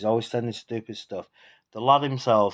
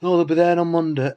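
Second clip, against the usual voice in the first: higher, louder mock of the neighbour's "Monday"; mocking her line; 6 semitones higher, 5 dB louder than usual. Mocking the neighbour's promise to his viewers, higher and louder. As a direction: throw her words back at her, higher.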